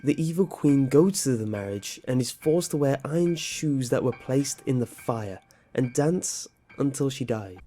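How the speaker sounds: usual speaking voice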